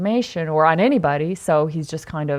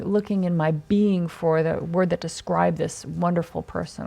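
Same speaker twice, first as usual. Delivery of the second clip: usual speaking voice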